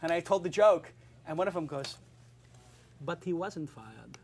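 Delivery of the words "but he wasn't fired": put-on slow, deliberate German accent. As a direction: quote a German colleague, slowly and literally. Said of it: "I can't do a German accent".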